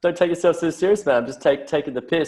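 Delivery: lightly voiced easygoing Aussie. Aussie telling Americans to relax, light teasing voice. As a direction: laugh it off, don't be serious. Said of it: "And I'm like".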